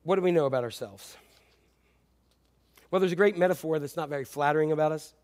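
normal talking voice

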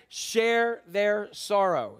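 "share their sorrow" drawn out very slowly, high and loud; slow stressed reading. Shown as a demonstration. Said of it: "Look at that part".

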